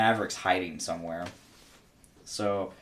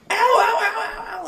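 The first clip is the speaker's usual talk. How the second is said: kid's mock ow; 6 dB louder than usual. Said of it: "he just starts".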